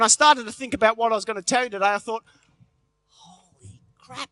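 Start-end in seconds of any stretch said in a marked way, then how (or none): 3.1-4.3 quiet dismay. Retold or imitated. Inner panic thought.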